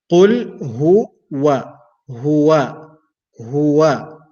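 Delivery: huwa with a throat h and a rounded waw; slow qul huwa, lips rounded on wa. Shown as a demonstration. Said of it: "I start ... lips are round".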